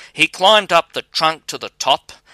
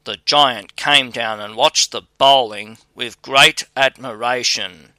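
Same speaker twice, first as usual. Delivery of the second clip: usual speaking voice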